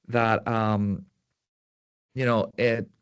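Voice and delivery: usual speaking voice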